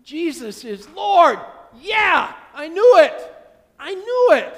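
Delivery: joyful shout. Retold or imitated. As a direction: shout it out in glad vindication.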